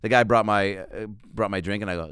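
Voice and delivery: usual speaking voice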